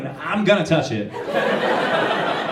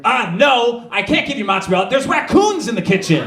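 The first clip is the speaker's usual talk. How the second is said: higher, sassy matter-of-fact tone; attitude; 7 semitones higher than usual. Retold or imitated. Kitchen lady telling him about raccoons, higher sassy attitude. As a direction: act like he's an idiot for asking. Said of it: "that's exactly how she said that, with, like, attitude".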